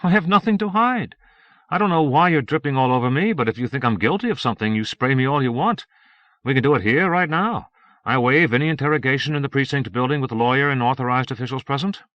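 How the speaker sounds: volunteer boldly as if nothing to hide; insistent innocence. Acted. Suspect bluffing, volunteering for truth test.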